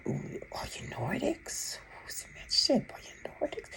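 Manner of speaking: whispered question. Retold or imitated. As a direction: ask it under your breath, in awe. Retelling silent question to ship.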